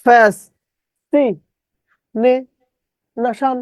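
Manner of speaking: ফ্যাস, সি, নে, শান in spaced syllables; distorted spelling pronunciation. Shown as a demonstration. Teacher repeating the trick, syllable-by-syllable pronunciation. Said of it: "কিন্তু এখানে কি বললাম?".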